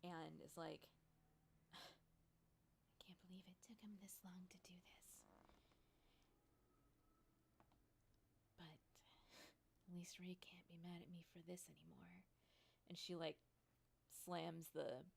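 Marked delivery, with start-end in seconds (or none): 1.3-12.2 much softer, half-whispered weary grumble; sigh, then grumble under your breath; tired, exasperated, nearly talking to yourself. Acted. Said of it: "she rubs her brow a little bit".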